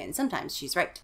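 ordinary delivery, nothing marked

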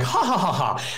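mock smug fake laugh; fake smug chortle. Mocking a rival streamer's laugh, smug fake chortle. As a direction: chortle smugly, faking it. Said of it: "smug, chortling, fake laugh".

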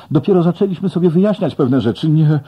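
loud, high, fast pleading; frantic plea to Jenny as she fades. A narrator's reading. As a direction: beg her not to leave. Begging vanishing Jenny to stay.

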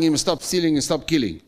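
normal talking voice

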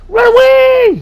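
high, loud playful shout; yelling run away. Acted. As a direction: yell it as you run off. Fleeing snowball fight, yelling.

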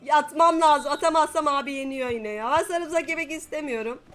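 ordinary talk

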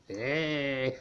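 normal talking voice

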